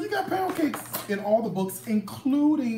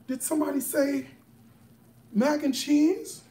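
excited; 6 semitones higher than usual. Real, not acted. Host thrilled to find mac and cheese, high excited exclamations. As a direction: gush with delight as if it's a wonderful surprise. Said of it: "I'm so excited".

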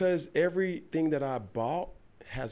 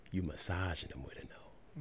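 soft; 4 semitones lower, 13 dB quieter than usual. Real, not acted. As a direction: say it gently. Gentle contrast to slapping.

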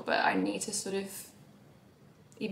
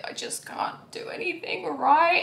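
despairing whine; 10 semitones higher, slower than usual. Retold or imitated. Voicing her despair.

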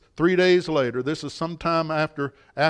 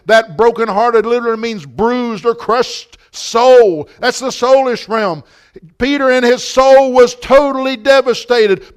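loud, raised preacher's voice, building in intensity; full-force preaching; 6 semitones higher, 9 dB louder than usual. Real, not acted. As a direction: preach it hard, hammering key words.

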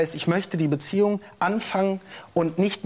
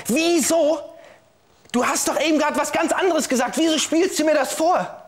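furious outburst; 9 semitones higher than usual. Acted. Betrayed witness confronting the woman in court, furious. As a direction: hurl the questions at her, stunned and outraged.

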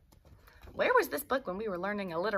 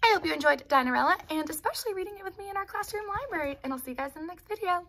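fast, bright wrap-up to students; cheerful sign-off; 8 semitones higher, faster than usual. Real, not acted. Teacher signs off to her class after the story, fast.

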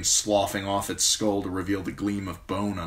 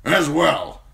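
old commander voiced higher and louder, blunt and forceful; a gruff old commander praising a young man; gruff old lord commander; 10 semitones higher, 8 dB louder than usual. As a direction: speak as the stern old Lord Commander, grateful but brusque. Mormont voice.

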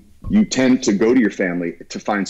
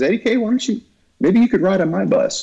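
quoted friend in a higher pitch; friend's casual invitation; 12 semitones higher than usual. Retold or imitated. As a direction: pitch it up, offering an idea. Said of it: "And he says".

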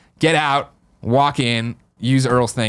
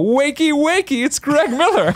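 much higher, slow, teasing sing-song; sing-song wake-up call; slower than usual. Retold or imitated. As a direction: chirp it like a cheeky morning alarm. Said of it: "And I go".

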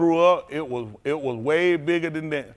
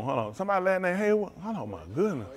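normal talking voice

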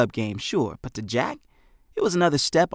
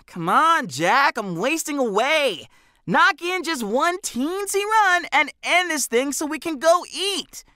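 put-on much higher boyish voice, whining to hurry up; a hungry kid begging his friend to end the game, pitched way up; best friend on the bleachers urging Jack on, higher and whiny; impatient best friend; 14 semitones higher than usual. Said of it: "Benny sighed loudly".

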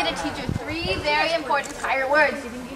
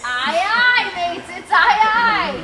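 loud, high hearty aye aye; pirate aye aye; 6 semitones higher, 7 dB louder, much slower than usual. Shown as a demonstration. Teacher shows kids pirate yes, loud and high. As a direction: bellow it like a ship's captain. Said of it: "Say it twice".

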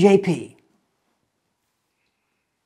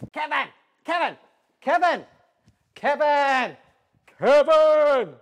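calling out; 11 semitones higher, slower than usual. Acted. Calling out repeatedly for Kevin.

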